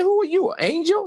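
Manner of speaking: amazed question. Retold or imitated. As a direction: gasp it out in astonished disbelief. Amazed reply to teacher.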